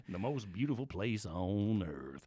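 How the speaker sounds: low, slow southern molasses drawl; southern accent. Shown as a demonstration. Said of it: "that beautiful southern molasses sort of accent".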